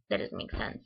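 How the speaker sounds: trails off into a whispered mutter; muttered under breath. Retold or imitated. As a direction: drop to a voiceless mutter, half to herself. Repeats the same words, voice drops.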